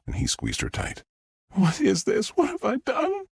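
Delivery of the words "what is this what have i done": very high, anguished voice; tearful distress. A narrator's reading. Star asks what she has done, tearful. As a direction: plead through tears, pitched way up. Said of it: "Tears streamed down her face".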